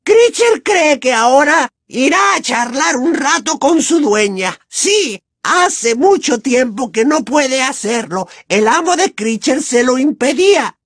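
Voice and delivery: high, slow wheedling elf voice; a sly, servile creature muttering to himself; creepy house-elf voice. Acted. House-elf Kreacher speaking.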